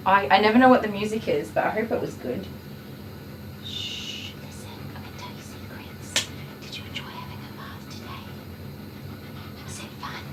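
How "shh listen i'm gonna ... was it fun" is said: very soft hush, then whispered questions; secretive whisper. Real, not acted. Groomer asking the dog about his bath, secretive whisper.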